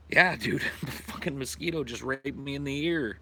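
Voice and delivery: normal talking voice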